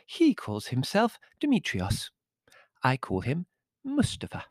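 high musical voice; chatty, lilting little-girl tones; girlish countess. A narrator's reading. Countess introducing her servant to guest, girlish lilt.

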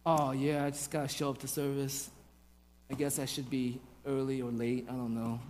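low, very soft apathetic mumble; mock someone showing up out of duty; listless, uncommitted murmur; half-hearted churchgoer. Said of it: "should be a praising and a celebration, not".

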